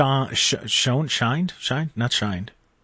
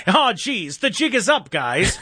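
put-on high, hammy old-time crook; 1930s gangster voice; 6 semitones higher, slower than usual. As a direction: squeal it like a caught 1930s crook. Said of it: "like a nineteen thirties criminal".

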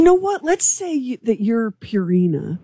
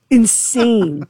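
indignant; 3 semitones higher than usual. Real, not acted. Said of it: "ranting about prices".

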